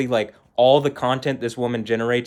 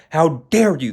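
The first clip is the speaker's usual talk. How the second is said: louder, mocking imitation of indignation; mock outburst; 3 semitones higher, 5 dB louder than usual. Daughter retelling mom's outburst, mock indignation. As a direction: punch "dare" like an affronted mother. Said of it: "That's what that whole outburst was".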